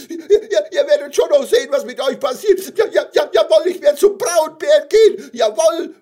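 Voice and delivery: very high, loud squeaky wail; tearful cry. Acted. Little gummy bear storms out from the others, tearful cry. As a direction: cry out defiantly through tears. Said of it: "rief beim Hinausgehen den anderen Gummibärchen unter Tränen".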